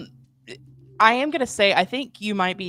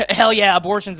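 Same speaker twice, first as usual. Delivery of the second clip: laughing; 2 semitones higher, 8 dB louder than usual. Real, not acted. Laughs at host's joke.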